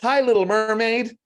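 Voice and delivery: put-on high, bright kid voice calling hello; child's squeaky greeting; little boy calling out. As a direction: call up like an excited four-year-old. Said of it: "He calls out".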